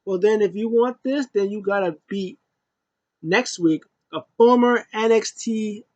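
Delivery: high, taunting mock voice; imitating Sasha. Vlogger imitating Sasha taunting Shayna, high and cocky. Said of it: "She was like".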